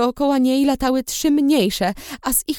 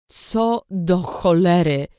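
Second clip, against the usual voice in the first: lightly voiced low, slow male voice; a slow, low startled curse; low man's voice; 5 semitones lower, slower than usual. A narrator's reading. Stranger startled by her vomiting, low slow voice. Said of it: "usłyszałam niski głos".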